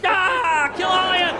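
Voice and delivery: high, strained maniac yell; hammed-up raging threat; mock rage. Attacker held down by police, mock rage.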